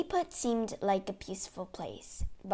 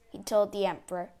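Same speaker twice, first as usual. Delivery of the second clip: plain reading voice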